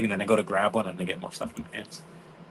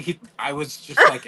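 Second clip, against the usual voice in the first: normal talking voice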